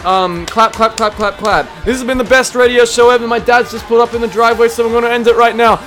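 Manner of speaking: radio host outro. Acted. Radio show sign-off.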